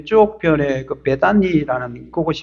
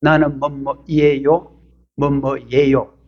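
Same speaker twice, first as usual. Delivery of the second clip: slow teaching demo; 2 semitones lower, much slower than usual. Shown as a demonstration. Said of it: "자기 소개 때 쓰는 문법".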